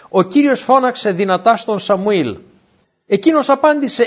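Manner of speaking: ordinary talk, nothing marked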